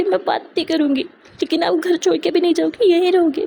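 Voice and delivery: lightly voiced sulky girl, pleading; a hint of a hurt girl begging her to stay; Shruti pleading.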